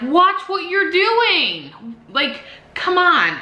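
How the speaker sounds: high, very slow, stretched scolding; angry warning. Retold or imitated. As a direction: drag it out, furious and disbelieving. Retelling yelling at the driver.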